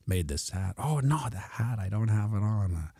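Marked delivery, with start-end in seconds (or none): none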